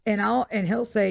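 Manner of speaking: ordinary talk, nothing marked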